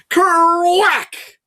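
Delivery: ordinary delivery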